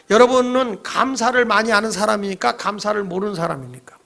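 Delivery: normal talking voice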